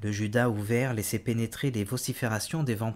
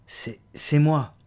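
frightened stammer, higher and louder, slow; 5 semitones higher, 8 dB louder, slower than usual. Acted. Woman caught in the dark stammers to the startled man, afraid. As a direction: stumble over the words in fright. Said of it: "balbutia quelqu'un dans l'ombre".

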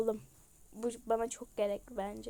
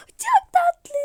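squealing; 15 dB louder than usual. Real, not acted. Squealing at cute chicken.

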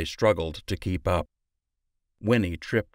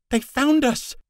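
much higher, louder panicked scream; terrified scream; 5 dB louder than usual. Acted. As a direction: scream it in terror as they're spotted. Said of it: "Holly screamed".

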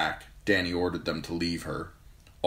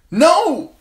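piercing high scream of "No!"; Dany screaming as the cask flies; 14 dB louder than usual. A narrator's reading. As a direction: scream as she falls. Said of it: "she screamed".

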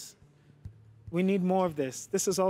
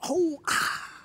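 breathy whispered gasp then a high exclaim; a hint of mock delighted gasps; mock gushing dancer; 8 semitones higher, 6 dB quieter, slower than usual. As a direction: act out an overexcited dancer reacting. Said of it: "She'll be like".

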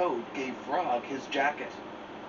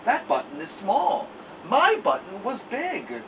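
higher, insistent Toad voice; fussy Toad; 9 semitones higher than usual. Acted. Toad protesting to Frog, higher and insistent. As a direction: Toad protesting it is not his.

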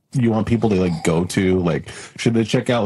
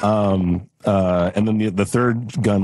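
normal talking voice

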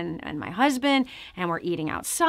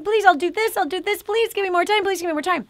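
higher pleading, please give me more time; begging the king; 6 semitones higher, faster than usual. Retold or imitated. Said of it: "he's begging".

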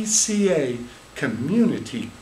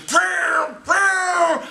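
high, loud whining "pew, pew" noise; transmission scream sound; 13 semitones higher, 3 dB louder than usual. Acted. Storyteller mimics the car's screaming transmission, high whine. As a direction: imitate the car's gearbox screaming.

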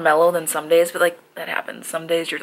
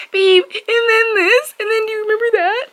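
put-on excited girlfriend voice; 4 dB louder than usual. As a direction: gush like an eager girlfriend. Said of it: "I'm like".

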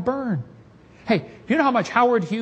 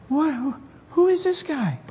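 stunned Yankee; 10 semitones higher than usual. Acted. Stunned Yankee soldiers react.

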